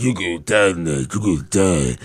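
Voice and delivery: imitating the wrestler's menacing catchphrase; mock menacing wrestler. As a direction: growl it like a monster wrestler. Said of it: "They're like".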